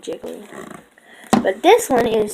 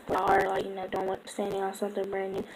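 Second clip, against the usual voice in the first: hushed aside; 3 semitones lower, 8 dB quieter than usual. Real, not acted. Teasing viewers mid-unboxing.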